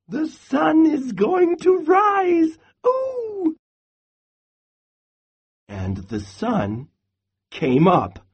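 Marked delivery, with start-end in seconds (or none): 0.1-3.6 very high, slow squeaky squirrel voice; piping little squirrel. A narrator's reading. Little squirrel contradicts Bear, piping high. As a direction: pipe it up high, ending in an excited squeal. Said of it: "Little Brown Squirrel piped up".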